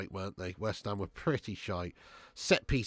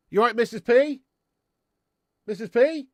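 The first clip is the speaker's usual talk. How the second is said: calling out; 12 semitones higher, 11 dB louder than usual. Real, not acted. Greeting mum in the kitchen.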